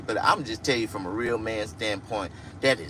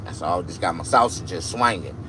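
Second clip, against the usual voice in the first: ordinary delivery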